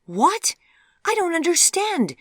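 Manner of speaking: high, loud, incredulous outburst; storming. A narrator's reading. Daughter protesting her mother's contradictory orders, storming. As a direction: storm back in exasperated protest. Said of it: "stormed Vivian".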